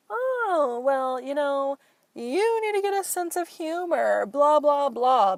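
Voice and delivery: high, loud mocking impression of a dismissive man. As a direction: sneer it in a squeaky put-on voice. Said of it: "this stupid fucker is just like".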